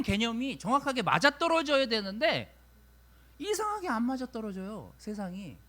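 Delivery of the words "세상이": ordinary delivery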